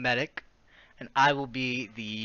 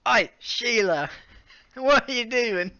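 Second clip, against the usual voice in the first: lightly hammed Australian boyfriend, louder at first; Australian accent; 9 semitones higher, 5 dB louder than usual. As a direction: call out in a playful Australian accent. Voicing Sniper in fanfic.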